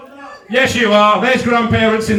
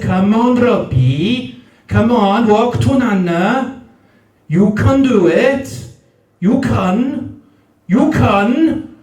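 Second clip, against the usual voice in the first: broad Newport lilt climbing in pitch; Newport accent; slower than usual. Retold or imitated. Granny Gail urging baby Robbie on, building Newport accent. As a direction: ever more drawn-out, swooping Newport vowels. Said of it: "the accent".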